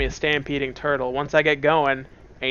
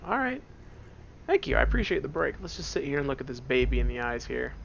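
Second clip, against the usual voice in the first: ordinary talk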